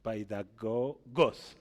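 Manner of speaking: "paidagogos" drawn out slowly, stressed on the last syllable, GOS; slow demonstration of "paidagogos" with end stress; stress on final O. Shown as a demonstration. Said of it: "el acento está en la última O".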